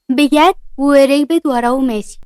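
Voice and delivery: plain reading voice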